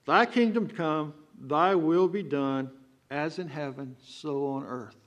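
thy kingdom come, slowly, word by word, with will stretched long; slow, deliberate prayer. Shown as a demonstration. Preacher showing the congregation how to pray it: slow and deliberate. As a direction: weigh every phrase of the prayer, slow. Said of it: "And so when we pray this".

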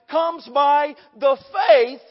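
"faith" stressed, loud and drawn out; slow down and punch the word "faith"; emphasis on FAITH. Real, not acted. Preacher tells church how saved lives are lived, emphasis on "faith". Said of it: "Putting a little emphasis on this word faith".

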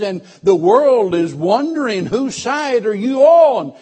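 loud, high, ringing preacher's call; full-force preaching. Acted. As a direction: preach it out to the whole congregation.

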